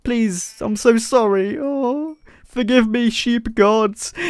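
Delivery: high, plaintive begging; mock pleading. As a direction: beg forgiveness in mock anguish. Begging forgiveness after killing the ram.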